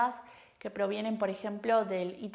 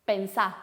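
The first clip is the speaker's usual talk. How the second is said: "Pensá" with the last vowel stressed, no R; stress on final vowel; 4 dB louder than usual. Shown as a demonstration. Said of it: "acentuamos la última vocal".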